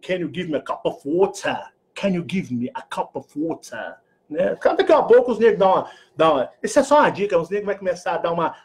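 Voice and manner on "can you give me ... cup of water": posh British English, dropping the r in water. Shown as a demonstration. Vlogger showing the British version after the American. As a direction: say it like a refined Brit. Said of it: "Ou em inglês britânico".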